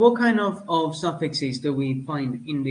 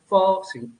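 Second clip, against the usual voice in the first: FOSSIL with FOS punched higher and louder; "fossil" with a strong, raised FOS, falling away; stress on first syllable; 4 semitones higher, 5 dB louder than usual. Shown as a demonstration. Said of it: "primary stress is in the first syllable".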